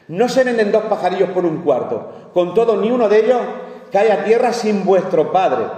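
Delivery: preaching. Acted. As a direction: proclaim the verse to the congregation. Preacher reading scripture aloud.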